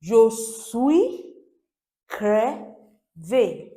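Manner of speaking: "Je suis crevé" slow and loud, "suis" swept upward; repeat-after-me model. Shown as a demonstration. Teacher asking learners to repeat, "Je suis crevé" loud and deliberate. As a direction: model "Je suis", pause, then "crevé" falling. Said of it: "repeat after me".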